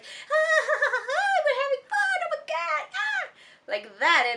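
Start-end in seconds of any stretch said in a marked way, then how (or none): 0.0-3.3 creepy, very high squeal with forced laughter; very weird voice. Retold or imitated. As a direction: shriek with manic mock glee. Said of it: "very weird voice".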